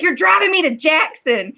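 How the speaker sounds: arguing with husband in Southern sass; sassy wife. Retold or imitated. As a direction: snap it at the husband with mock exasperation. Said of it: "I'd be like".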